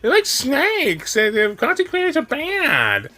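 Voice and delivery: high, drawn-out mocking parody; exaggerated mock complaint; mocking quote. Mocks the other streamer's point.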